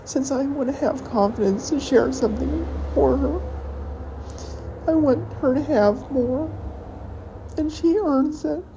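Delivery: put-on half-hearted emotional plea about the girlfriend; emotional outburst. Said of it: "I'm just having a very emotional evening".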